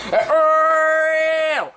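long, high wail; high mock scream. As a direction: let out a long play scream. Figure cries out in play.

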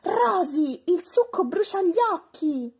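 high, loud furious complaint; cross little dinosaur voice; angry protest. A narrator's reading. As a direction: shout it out indignantly. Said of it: "Momi, infuriato, protestava".

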